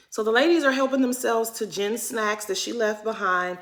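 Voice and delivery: usual speaking voice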